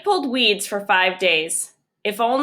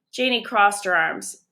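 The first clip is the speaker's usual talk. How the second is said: plain reading voice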